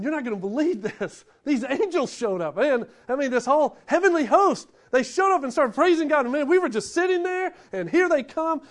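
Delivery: the guy who can't wait to tell everyone; gushing about the angels to anyone who'll listen; excited bragging. Retold or imitated. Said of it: "I'm just telling you what I would do".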